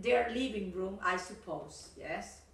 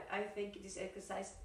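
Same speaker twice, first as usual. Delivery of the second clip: ordinary delivery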